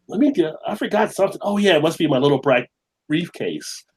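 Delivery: hurried put-on forgetfulness, settling down at the end; elevator gag act. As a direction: act out suddenly remembering something, starting high. Acting out his elevator gag.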